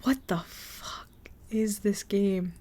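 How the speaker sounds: slow disbelieving whisper. Real, not acted. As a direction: breathe it out, stunned, slowly. Whispered disbelief at weird game.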